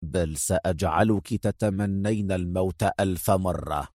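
low, soft, cruel promise; quiet cruel menace; harsh. A narrator's reading. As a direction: threaten her coldly. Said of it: "أجابها في قسوة".

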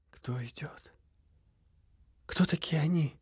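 feverish whisper. Acted. Feverish dog asks in dream.

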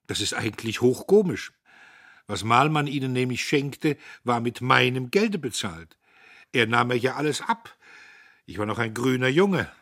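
put-on a cocky young man laughing off an old story; Dietrich amused.

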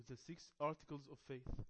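very slow, drawn-out English title; slow dictation. Shown as a demonstration. Teacher names the assigned video, slow dictation. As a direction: stretch out each word for learners.

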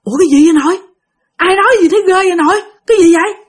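high, loud startled question; scared child waking grandma; frightened child. Retold or imitated. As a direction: ask grandma in a panic. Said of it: "Bạn mới hoảng hồn".

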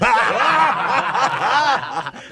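a hearty, high mocking laugh; pirate captain's laugh. Pirate captain laughing at his crewman's worry, mocking laugh. Captain mocks the crew's doubts.

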